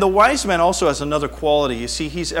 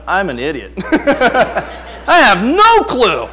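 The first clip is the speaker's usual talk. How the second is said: laugh, then loud drawn-out confession; hammed-up clueless parent, high and loud on the end; exasperated self-mockery; 6 semitones higher, 7 dB louder, much slower than usual. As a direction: own up helplessly, stretching the last words. Acts out new parent admitting cluelessness.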